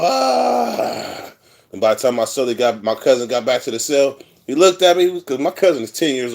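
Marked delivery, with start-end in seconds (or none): 0.0-1.3 imitated cry-out. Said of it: "just let it all out".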